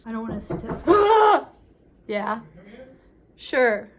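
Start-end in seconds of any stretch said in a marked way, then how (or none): 0.9-1.5 loud shocked gasp; a big gasp of shock; sharp gasp. Real, not acted. Shocked reaction to kiss scene.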